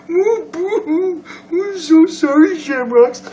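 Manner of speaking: very high, drawn-out mock sobbing; fake boo-hoo crying. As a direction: wail a sarcastic, squeaky apology.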